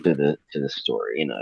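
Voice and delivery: ordinary talk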